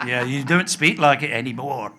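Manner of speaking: loud mock Glaswegian accusation; Glasgow retort. Glaswegian doubting he's from Edinburgh, loud mocking retort in Glasgow accent. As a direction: throw it back hard in an imitated Glasgow accent. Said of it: "I would get this thing in Glasgow".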